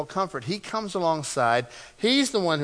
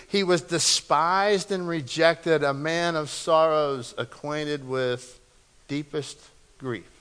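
solemn scripture reading; 2 semitones lower, slower than usual. Real, not acted. Preacher reads Isaiah on the man of sorrows, solemn and slowing. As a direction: let each phrase slow and sink toward the last word.

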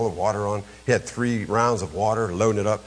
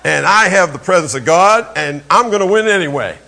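boastful shout; 7 semitones higher, 8 dB louder than usual. Retold or imitated. Boyhood boast while losing.

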